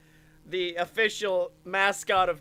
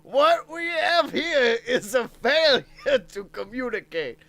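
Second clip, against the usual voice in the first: movie quote voice; 6 semitones higher, 6 dB louder than usual. Pretend. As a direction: ham up a classic film line. Famous movie quote imitation.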